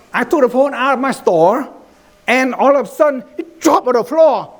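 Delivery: animated, high-pitched storyteller; storytelling demo. Shown as a demonstration. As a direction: tell it dramatically, pitch climbing as it goes. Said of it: "I'm gonna tell you a story".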